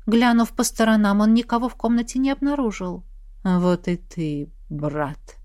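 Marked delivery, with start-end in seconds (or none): 3.4-5.4 eerie shadow voice. Acted. Shadow voice greets the prince.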